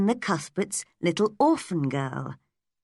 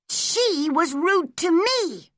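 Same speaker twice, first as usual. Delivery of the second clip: put-on hurt girl; 12 semitones higher, slower than usual. A narrator's reading. Hurt child protests.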